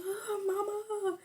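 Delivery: high, slow imitated sob of "Oh, mama"; hammed-up retold crying; mock crying wail. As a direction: wail it like a crying kid, stretched out. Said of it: "Smokey was crying".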